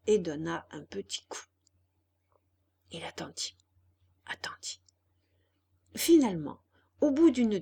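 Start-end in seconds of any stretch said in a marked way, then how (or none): 2.9-4.8 attendit, attendit fading from soft to whispered; hushed waiting. A narrator's reading. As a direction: voice drops to a whisper on the repeat. Narrator draws out the waiting.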